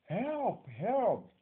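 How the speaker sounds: high, loud cry for help; shouted plea. Shown as a demonstration. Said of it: "someone will shout".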